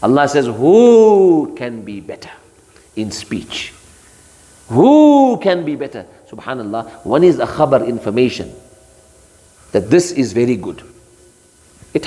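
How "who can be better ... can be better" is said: slow emphatic who; emphatic challenge. Shown as a demonstration. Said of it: "The second statement is much more emphatic".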